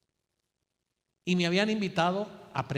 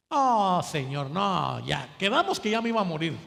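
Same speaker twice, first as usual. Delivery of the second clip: queja exagerada; 6 dB louder than usual. Acted. Elías protesta al Señor.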